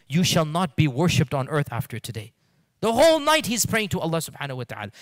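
loud, high, impassioned sermon voice; urging the congregation to pour out their hearts, preaching at full force; fiery preaching. Acted. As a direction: preach it hard to the crowd. Opening exhortation at full force.

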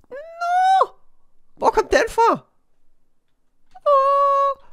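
high, startled yelps; startled squeals. Real, not acted. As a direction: jump at the sudden mob. Mob suddenly appears. (høje forskrækkede hvin)

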